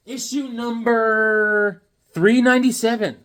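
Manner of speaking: slow booming announcer read. Acted. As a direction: proclaim it like a game show host. Announcing comic issue number.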